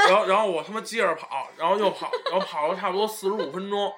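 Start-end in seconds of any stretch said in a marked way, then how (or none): none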